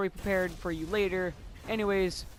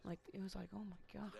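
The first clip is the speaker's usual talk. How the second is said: hushed, unvoiced disbelief; whispered aside; 19 dB quieter, faster than usual. Students react to her hitting herself, whispered reaction. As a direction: whisper it under your breath. Aside closing the retold incident.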